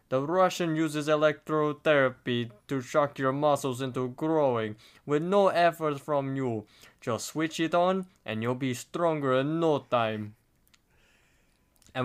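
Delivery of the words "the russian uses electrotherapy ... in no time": put-on Russian TV salesman with a thick accent. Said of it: "and the Russian guy says".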